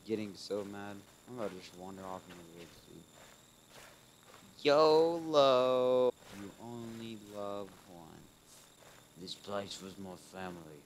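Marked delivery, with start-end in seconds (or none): 4.6-6.1 mock shout. Jokey exclamation.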